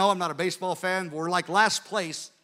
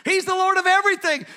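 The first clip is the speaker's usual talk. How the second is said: higher, louder proclamation of faith; fervent exclamation; 10 semitones higher, 4 dB louder, faster than usual. Retold or imitated. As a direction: declare it with rising zeal. Bob's fervent retold reply.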